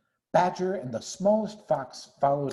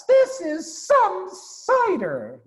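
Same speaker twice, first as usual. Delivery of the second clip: awed; 6 dB louder, much slower than usual. Acted. Cub after strong cider.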